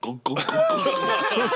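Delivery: high, slow laughing chant; laughing cheer. Real, not acted. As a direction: cheer him on through laughter. Cheering the fleeing halfling.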